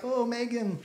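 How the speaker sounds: woman quietly complaining to Megan, hushed and uneasy; creeped-out gossip. Retold or imitated. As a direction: confide it quietly, unsettled. Woman telling friend, creeped out.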